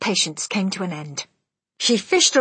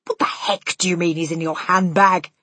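gruff, indignant outburst; angry shout; 3 dB louder than usual. Acted. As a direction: bark it at her in disbelief. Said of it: "Mister Jenkins shouted".

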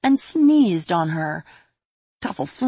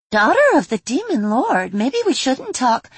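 wary fairy voice; 8 semitones higher, 4 dB louder than usual. Acted.

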